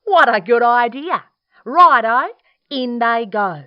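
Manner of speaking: loud, high, gleeful cheer; delighted dingo. Acted. Dingo approving Platypus's mud idea, loud and delighted. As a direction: welcome the idea with big loud glee. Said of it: "Dingo laughed".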